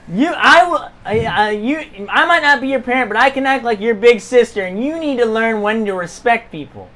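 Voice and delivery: heated, stumbling reprimand; stern scolding. Acted. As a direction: lecture like a fed-up big sister. Big sister lecturing a child.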